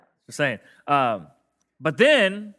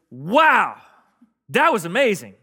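demo wow; 4 semitones higher, 6 dB louder, slower than usual. Shown as a demonstration. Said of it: "Can you do that for me?".

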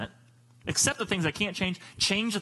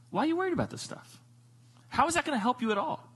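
gentle disbelief; 7 semitones higher than usual. Acted. Jesus gently questioning the worriers.

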